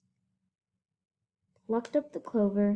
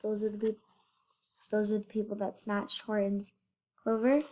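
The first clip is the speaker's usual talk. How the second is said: ordinary delivery, nothing marked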